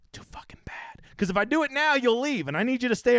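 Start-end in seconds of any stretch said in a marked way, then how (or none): 0.1-0.7 whispered, unvoiced jab; teasing whisper. Real, not acted. Host teasing the new guy in a whisper. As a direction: breathe it out as a sly tease. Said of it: "teasing new guy".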